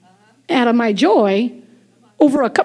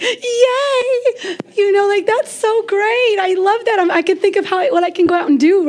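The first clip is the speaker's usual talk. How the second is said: mock-delighted cheer; 5 semitones higher than usual. Wife hears husband's good news, high gushing delight. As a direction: replay the joy in a high, stretched voice.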